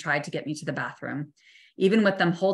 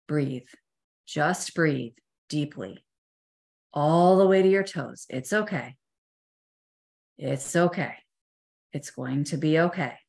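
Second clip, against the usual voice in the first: self-soothing. Retold or imitated. Calming herself in the ambulance.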